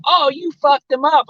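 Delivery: high-pitched mock outcry; twins' alarmed exclamation. Twins running out at the storyteller, imitated high exclamation.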